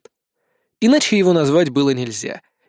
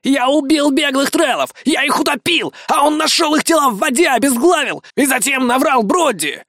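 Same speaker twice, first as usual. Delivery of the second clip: high, raised yelling claim; 9 semitones higher than usual. A narrator's reading. Iggy points at the hero and yells to the crowd, high raised voice. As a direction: shout it at the crowd, pitched up. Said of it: "заорал".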